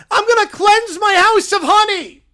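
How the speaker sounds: high, loud, triumphant cub; little bear vowing loudly; excited bear shout. Acted. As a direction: yell it like a sudden revelation. Bear vows.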